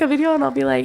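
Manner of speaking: usual speaking voice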